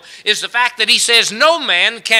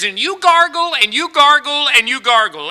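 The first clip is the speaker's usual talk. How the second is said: doctor's gargle instruction; 8 semitones higher, 6 dB louder than usual. Acted. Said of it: "And he says".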